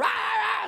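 high, loud comic shout; a brother yelling at his sister; the son's high, loud hammed-up yell at his sister; mock yell. Son yells at sister.

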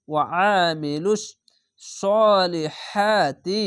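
সলিহাতি drawn out very slowly, the long vowel held; Quranic recitation. Shown as a demonstration. Teacher recites the phrase for students, slow drawn chant. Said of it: "এক আলিফ টেনে পড়বেন".

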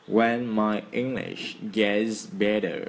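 very slow, drawn out; slow repeat. Shown as a demonstration. Teacher repeating the ending slowly for students. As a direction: read it slowly for learners. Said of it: "Nhắc lại nhá".

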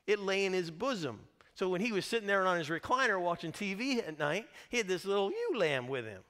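ordinary talk, nothing marked

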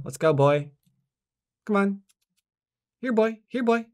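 high coaxing call to a dog; calling a lost pet. Pretend. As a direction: call him like a stray puppy.

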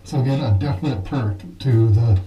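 muffled voice behind a mask; through a gas mask. Real, not acted. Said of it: "you're definitely able to hear".

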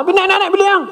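high-pitched imitation of a scolding dad; mock scolding father. Father scolding his child about going up to the room, imitated high. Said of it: "Oh, marah saya".

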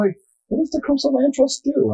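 high-pitched imitation of a curious newcomer; mock wondering. Trustee recalling not knowing about the land trust, high-pitched wondering. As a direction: ask it way up high, as your clueless past self. Voices own past puzzlement.

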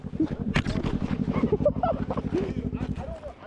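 long high-pitched laugh; a drawn-out, high burst of laughter. Real, not acted. Laughing during playful banter.

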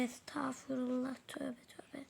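under-the-breath whispered tövbe tövbe; horrified whisper. Real, not acted. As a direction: hiss it to yourself. Muttering shocked at the odd items.

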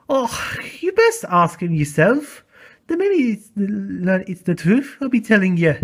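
offended Scottish engine voice; huffy Scottish brogue, offended; Scottish accent. Acted.

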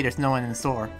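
ordinary delivery

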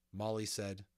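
ordinary delivery, nothing marked

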